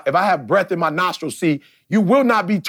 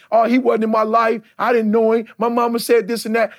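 mocking his old self; 7 semitones higher than usual. Imitating his old complaining self.

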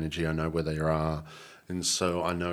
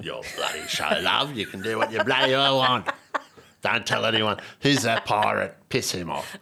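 hearty pirate growl; 4 semitones higher, 3 dB louder than usual. Pretend. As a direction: talk like a salty pirate. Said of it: "Slipped into the pirate voice".

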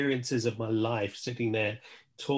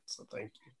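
soft whispered thanks; 18 dB quieter, faster than usual. Real, not acted. Closing thanks to the guest.

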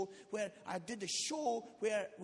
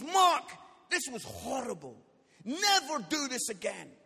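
loud, acted condemnation; stern rebuke; 8 dB louder than usual. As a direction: imitate a church leader laying down the law. Church leader scolds him after show.

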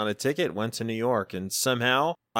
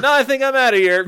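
higher, louder, bolting for the door; high loud bail-out; 12 semitones higher, 10 dB louder than usual. Retold or imitated. Telling the room owner he's out, high and loud. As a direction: announce your exit in a loud high panic. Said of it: "I was just like".

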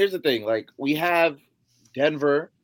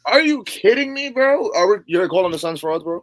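loud, high indignant challenge; incredulous outrage; 6 semitones higher, 7 dB louder than usual. Real, not acted. Fan challenging his co-host over calling the Suns frauds, incredulous. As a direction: fire it back in loud disbelief.

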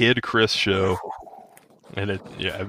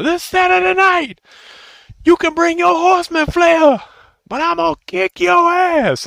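much higher, loud promo voice; Dusty Rhodes promo; 8 dB louder than usual. Retold or imitated. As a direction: hype the match like a wrestler on the mic. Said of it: "spot-on Dusty Rhodes impression".

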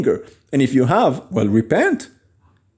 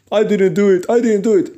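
higher-pitched mock protest; stubborn denial; 5 semitones higher, faster than usual. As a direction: deny it like a stubborn fool, acted out. Acting out a stubborn person's denial.

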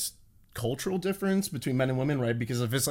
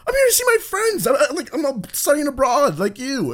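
flustered; 6 dB louder than usual. Retold or imitated. Retold own flustered reply, high.

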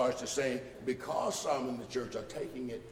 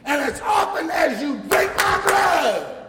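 full-force preaching; 11 semitones higher, 11 dB louder than usual. Acted. Preacher rousing the congregation about communion, full-force preaching. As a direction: preach it out to the back pews.

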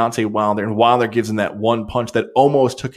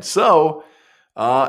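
ordinary talk, nothing marked

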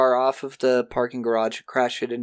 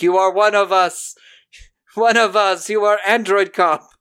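raised, loud, insistent recruiting; robot recruit voice; 6 semitones higher, 6 dB louder than usual. Retold or imitated. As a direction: chant it like a cult welcome. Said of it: "Andy is like".

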